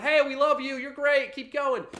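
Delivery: high, loud cheerleading voice; mock cheering. Past selves cheering him on in a vision, high mock cheering. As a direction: pile on hammed-up encouragement, high and loud.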